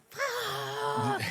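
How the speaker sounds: a single full-voice high shriek of frustration; all-out scream. Retold or imitated. Alone in her car, venting frustration out the window: a full scream. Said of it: "and I screamed".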